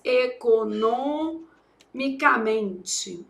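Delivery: "economicamente" bem devagar, sílaba por sílaba, com pausa depois de NO; derivada ditada. Shown as a demonstration. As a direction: dite "economicamente" em pedaços, alongando as sílabas. Dita a derivada enquanto escreve. ("economicamente" separado em sílabas, como quem escreve)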